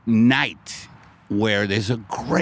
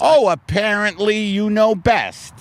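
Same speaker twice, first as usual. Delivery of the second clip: higher, mocking sing-song on apparently; sarcastic; 8 semitones higher, 4 dB louder than usual. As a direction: sneer it like a know-it-all. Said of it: "it's a sarcastic word".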